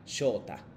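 "shota" with a long o and no distinct u; u left unstressed. Shown as a demonstration. Teacher modelling his own name, "shota" with the u unsounded. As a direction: glide through "shota" as shoo-ta, the u melted away. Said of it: "no pronuncia como fuerte".

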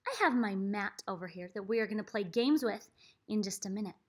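usual speaking voice

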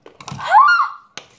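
a sudden, very high, loud gasp; sharp high gasp. Real, not acted. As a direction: gasp as the fumes hit your eyes. Onion fumes hit her.

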